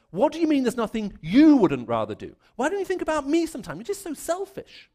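high, rising, hammed-up complaint from the wife; mock accusing wife. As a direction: play the wronged wife, half in jest. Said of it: "she never looks at me and says".

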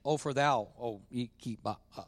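low, faltering archaic pronouncement; mock King James. Pastor spoofing God speaking King James, mock-solemn. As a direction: fumble through old Bible English, trailing off quietly. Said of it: "God doesn't speak King James".

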